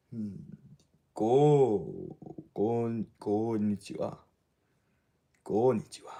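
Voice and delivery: deep, forced character greeting; forced low voice. Shown as a demonstration. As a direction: push the voice down low. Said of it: "It's a lot more, like, forced".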